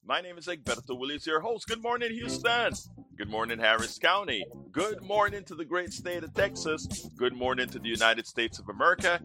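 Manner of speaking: high, rousing radio host delivery; show-opening announcer. Acted. Host greets Houston and the world, rousing announcer.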